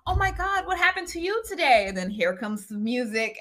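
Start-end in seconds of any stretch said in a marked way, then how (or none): 0.1-1.8 high, sing-song mock gush; musical character voice. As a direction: ham it up like a musical scene. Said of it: "they'll just be sitting there just talking".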